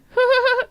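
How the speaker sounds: loud, high giggle; laugh. Real, not acted. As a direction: burst into a short high laugh. Laughing at own remark.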